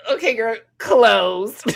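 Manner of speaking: giggling slow mock; laughing. Real, not acted. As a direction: squeeze it out while cracking up. Laughing mockery of closed eyes.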